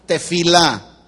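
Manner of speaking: "Tefilá" dit lentement, accent sur le A final; répétez "Tefilá" clairement, syllabe finale appuyée; pron tefilá. Shown as a demonstration. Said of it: "¿Cómo es?".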